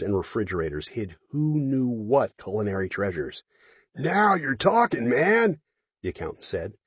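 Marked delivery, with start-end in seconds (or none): 3.9-5.6 put-on drunk guy voice. Drunk accountant speaking.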